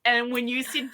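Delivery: usual speaking voice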